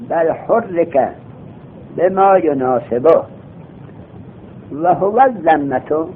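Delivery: slow recitation. Shown as a demonstration. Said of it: "slow recitation of text".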